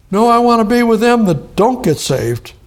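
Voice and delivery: high, loud mock of a stubborn objector. Preacher mocking a stubborn unbeliever, hammed-up high voice. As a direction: ham up the silly excuse.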